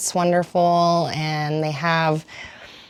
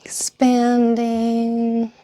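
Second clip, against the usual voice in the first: slow, stretched cues with long pauses; guided yoga flow; 3 semitones higher, slower than usual. Acted. Yoga teacher cueing several rounds with the breath, slow and drawn out. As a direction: let each word last as long as a breath.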